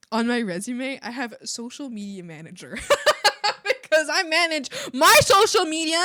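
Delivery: normal talking voice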